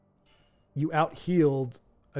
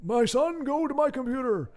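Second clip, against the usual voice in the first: put-on high, slow dying old man; 7 semitones higher than usual. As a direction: speak like a frail dying elder. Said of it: "talking like an old man".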